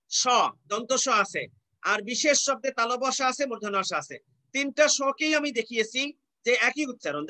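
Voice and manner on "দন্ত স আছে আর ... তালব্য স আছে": normal talking voice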